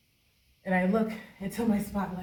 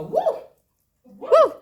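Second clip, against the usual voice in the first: much higher, loud whooping cheer; crowd cheering; 14 dB louder than usual. Retold or imitated. Ghost crowd cheering the dancer, loud high whoops. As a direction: whoop like a wild audience. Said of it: "and they would go".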